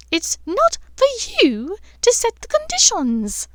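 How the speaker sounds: high, loud, sour character voice; acidly. A narrator's reading. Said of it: "Professor Umbridge said acidly".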